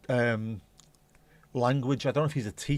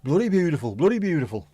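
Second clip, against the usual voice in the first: loud, high bloody beautiful; excited exclaim; 5 semitones higher, 6 dB louder than usual. Real, not acted. Admiring the picture on screen, loud and excited. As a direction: gush loudly over the picture.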